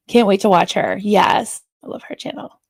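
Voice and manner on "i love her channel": whisper. Real, not acted. Softly praising a channel.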